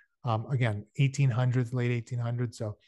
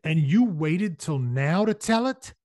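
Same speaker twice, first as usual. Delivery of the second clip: higher, louder, slow disgusted question; 5 semitones higher, 5 dB louder than usual. A narrator's reading. Shane rebuking Johnson for holding back news, disgusted. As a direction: draw it out in disgusted disbelief. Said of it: "There was disgust in Shane's voice".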